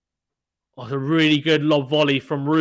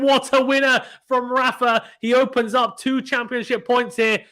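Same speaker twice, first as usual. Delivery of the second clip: higher, louder praise for the winner; excited call; 8 semitones higher, 3 dB louder than usual. Real, not acted. Commentator hailing Rafa's championship-point winner, excited. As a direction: ring out the winner. Commentator reacting to winning shot.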